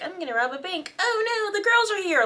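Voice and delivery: high, hammed-up villain panic; mock villain panic. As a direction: squeal it like a cartoon crook. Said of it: "Captain Cold being like".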